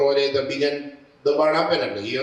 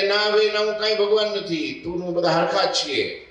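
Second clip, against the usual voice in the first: put-on a young saint waving off the idea, raised voice; Maharaj gently denying; 9 semitones higher than usual. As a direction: sage brushing it off. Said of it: "મહારાજ કે".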